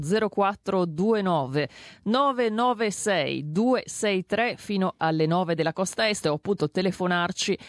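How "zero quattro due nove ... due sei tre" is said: phone number read very slowly, digit by digit; slow, deliberate. Real, not acted. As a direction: spell out each digit slowly for listeners. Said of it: "Lo dico lentamente". (lentamente, scandendo ogni cifra)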